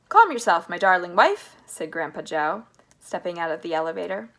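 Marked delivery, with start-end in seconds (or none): 0.1-1.6 loud, firm soothing call; firm reassurance. A narrator's reading. Grandpa Joe calls to his frightened wife, firmly reassuring. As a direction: raise your voice to settle her. Husband calling over panicked wife's cries.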